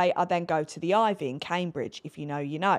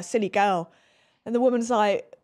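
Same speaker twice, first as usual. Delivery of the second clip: ordinary delivery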